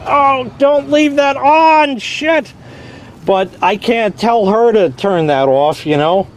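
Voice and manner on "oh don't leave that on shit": alarmed exclamation. Retold or imitated. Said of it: "I'm like".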